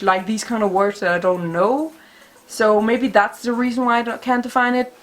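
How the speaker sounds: ordinary delivery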